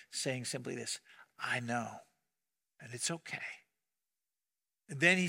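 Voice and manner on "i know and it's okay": much softer, tender reassurance; almost hushed, gently consoling; voice of Christ. Retold or imitated. Christ comforting the weeping pastor, soft and tender. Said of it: "the voice of Christ speak to him".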